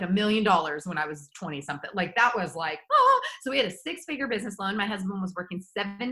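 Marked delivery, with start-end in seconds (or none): 2.9-3.2 high startled oh; shocked gasp. Retold or imitated. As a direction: gasp at the number. Reacting to huge loan.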